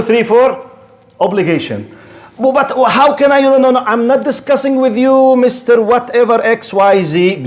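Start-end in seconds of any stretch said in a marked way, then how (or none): 2.4-7.4 high-pitched, insistent refusal; flustered protest. Acted. Believer to a doubter about his faith, high insistent refusal. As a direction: cut off the debate, voice pitched up.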